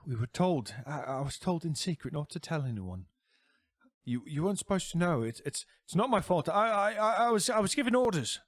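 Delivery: high, stuttering, rushing; high stammering plea; stammering fear. Acted. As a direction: beg for your life, stammering. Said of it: "And he goes".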